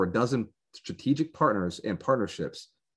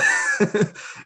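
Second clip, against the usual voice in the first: high breathy laugh; 13 semitones higher, 5 dB louder than usual. Real, not acted. Amused at the odd price.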